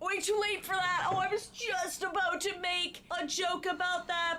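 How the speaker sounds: high, animated outburst; amused shock. Real, not acted. Viewer hearing the video make their joke, amused shock. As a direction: exclaim it high, as if beaten to the joke.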